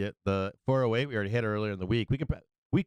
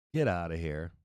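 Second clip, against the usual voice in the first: low, soft scoff; a quiet, low get-outta-here scoff; dismissive scoff; 8 semitones lower, 4 dB quieter than usual. Real, not acted. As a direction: brush the idea off, dropping the voice low. Dismissing a caller's bad idea.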